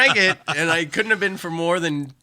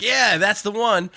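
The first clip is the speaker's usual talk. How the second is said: sarcastic agreement; 8 semitones higher, 3 dB louder, slower than usual. Retold or imitated. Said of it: "no one else in the band was like".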